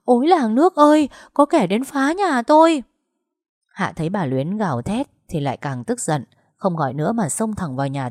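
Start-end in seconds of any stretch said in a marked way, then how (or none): 0.0-2.8 high-pitched, loud wail of alarm; frightened cry for help. A narrator's reading. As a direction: rouse the whole village in a panic. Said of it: "sợ quá kêu lên". (kêu cứu thất thanh, hoảng sợ)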